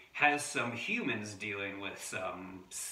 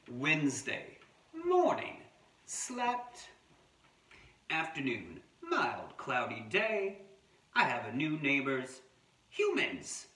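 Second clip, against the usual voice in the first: short entries with pitch leaping up then falling; wombat diary narration; 5 semitones higher, 4 dB louder, slower than usual. Acted. Adult reading a wombat's diary to children, animated terse narration. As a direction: read a wombat's log in separate, paced phrases.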